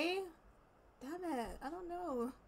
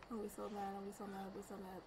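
low, soft grumbling on repeat; under-the-breath threat of getting mad; muttered annoyance; 5 semitones lower, 10 dB quieter, much faster than usual. Real, not acted. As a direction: grumble it to yourself, trailing away quieter.

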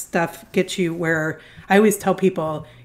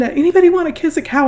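put-on much higher, louder, fast eager child shout; the daughter bursting in, high and fast; excited little girl; 10 semitones higher, 7 dB louder, faster than usual. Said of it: "she comes running in".

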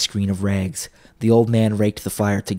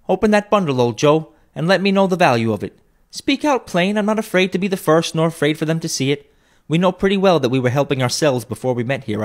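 brazen woman; 7 semitones higher than usual. A narrator's reading. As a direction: chide her for staring. First woman voiced.

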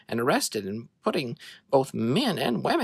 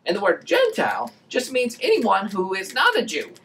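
higher, louder, upbeat teaching voice; energetic children's presenter; kids' show host explaining; 8 semitones higher, 6 dB louder than usual. Acted. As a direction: explain it cheerfully to young children.

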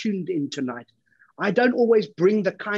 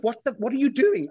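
startled; 7 semitones higher than usual. Acted. Assistant shocked at customer on floor.

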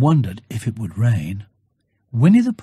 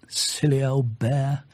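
plain reading voice

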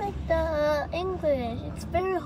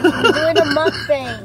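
big excited announcement; loud announcing; 12 dB louder than usual. Real, not acted. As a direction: shout it to the viewers. Child announcing to viewers.

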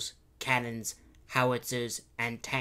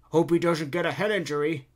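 higher, louder put-on old man; grandpa's voice; 5 semitones higher, 8 dB louder than usual. Grandpa watching the bus batter the gate, loud old-man voice.

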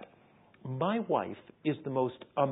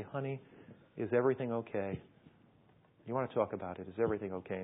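soft, low comforting voice; gentle mother; 4 semitones lower, 5 dB quieter, faster than usual. Acted. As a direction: ease your daughter open quietly. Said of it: "you talk to her gently and tenderly".

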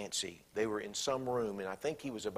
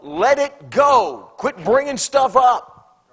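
much higher, louder, slowed preaching cry; preaching at full force; emphatic plea; 12 semitones higher, 17 dB louder, much slower than usual. Real, not acted. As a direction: drag out each word like a plea from the pulpit.

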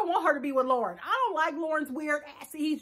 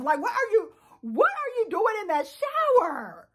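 incredulous; 5 semitones higher than usual. Real, not acted. Shocked reaction to shower sounds.

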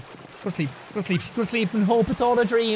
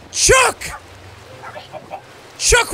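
shout; 9 semitones higher than usual. Real, not acted. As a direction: call out in alarm. Shouting the chicken's name.

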